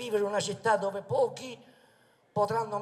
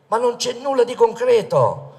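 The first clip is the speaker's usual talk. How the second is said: loud, high, impassioned rally oratory; booming balcony speech; 4 semitones higher, 16 dB louder than usual. Acted. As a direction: thunder it out to the crowd like a politician. Rally speech at full force.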